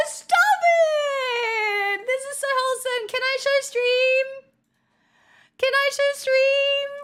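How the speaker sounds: high, delighted squeals of disbelief; can't contain the joy at the gift; gleeful squeal. Real, not acted. Streamer gushing to chat over a present, high excited squeal.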